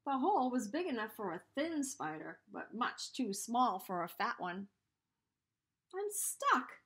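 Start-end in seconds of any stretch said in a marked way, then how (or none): 5.9-6.8 cried out. A narrator's reading. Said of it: "Anansi cried".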